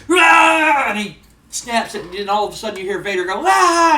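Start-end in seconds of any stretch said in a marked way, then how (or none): 0.1-0.9 high, loud wrestler's roar; fierce attack yell. Acted. Ken snaps Vader's ankle, loud attacking yell. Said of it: "And then Ken goes". 3.5-4.0 high, loud acted pain cry; scream of pain. Vader cries out as his ankle snaps, loud scream. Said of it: "he screams out in pain".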